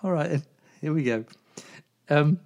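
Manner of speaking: ordinary talk, nothing marked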